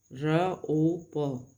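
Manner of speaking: र ओ प, each sound slowly and separately. Shown as a demonstration. Teacher sounding out रोप for students. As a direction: stretch out र ओ प one piece at a time. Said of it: "तो रोप को जब हम बोल रहे हैं".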